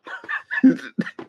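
high, loud breathy laugh; burst of laughter. Real, not acted. Cracking up at the witness's answer in the clip.

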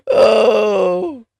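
high burst of real laughter; cracking up loudly. Real, not acted. Laughs at robot bit.

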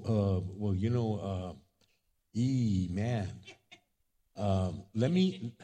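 low, halting excuse-making; hesitant stalling. Acted. Zacchaeus puts off a house guest, hesitant and low. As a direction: stall awkwardly with ums and pauses.

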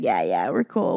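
low, laid-back mock swagger; playing it cool. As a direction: drop it low, too cool to care. Acting out twinning friends being cool.

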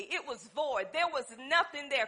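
ordinary talk

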